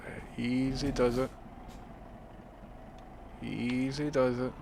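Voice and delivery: soft, slow easy does it; careful murmur. Real, not acted. Driver talking himself through a tight exit, soft and slow. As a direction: coax the truck out gently under your breath.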